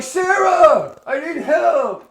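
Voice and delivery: loud, high, drawn-out plea for help; desperate yell. Retold or imitated. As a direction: call out for help in mock distress. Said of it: "You're gonna be like".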